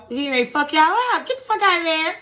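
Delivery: high-pitched, exclaimed warning; incredulous outburst. Acted. Storyteller warns nobody messes with G I Joe, incredulous outburst. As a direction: exclaim it high, in mock alarm. Teller's mock outburst about the veteran.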